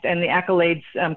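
usual speaking voice

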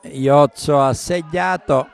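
loud, slow goal announcement; excited announcement. Real, not acted. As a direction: proclaim the scorer. Announcing the scorer.

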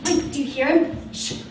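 ordinary talk

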